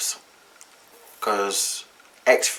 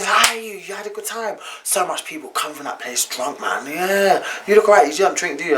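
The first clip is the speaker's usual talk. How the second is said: cheery driver; 6 semitones higher than usual. Retold or imitated. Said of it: "So he was just talking".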